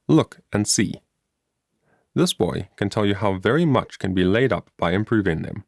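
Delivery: plain reading voice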